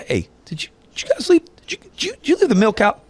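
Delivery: high-pitched, stumbling mock interrogation; dad questioning kids. Dad asking his kids about the milk, high mock questioning. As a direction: act out a dad's sudden accusing question. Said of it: "it's like my kids".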